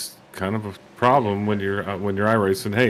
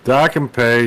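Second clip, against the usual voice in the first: put-on playful Canadian accent, loud; 3 semitones higher, 7 dB louder, slower than usual. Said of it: "You keep doing the Canadian voice".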